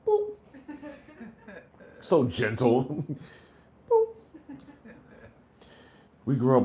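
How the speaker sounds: normal talking voice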